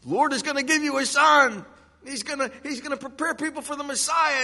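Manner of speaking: high, loud burst of excitement; excited, high-pitched announcement; all excited. Acted. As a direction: burst out with thrilling news. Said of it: "should have been all excited".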